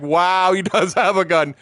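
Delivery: high, loud astonished wow with a laugh; amazed laughing. Retold or imitated. Teller sees the guard's gun, high laughing amazement.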